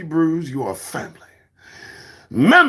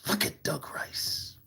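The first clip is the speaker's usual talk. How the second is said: whisper; 14 dB quieter than usual. Acted. Self-mocking aside.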